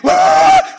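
loud high-pitched wail for a pack of dogs; frantic dogs barking imitation. Preacher mimics eight frantic dogs, loud high howling cry. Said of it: "no, es".

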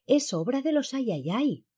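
high, slow exclamation; exclamación. A narrator's reading. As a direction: cry it out high, drawing it out. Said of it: "exclamó". (exclamación aguda y lenta)

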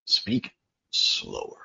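gentle demonstration of slowing down; slow soft demo. Shown as a demonstration. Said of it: "If you want people to understand you".

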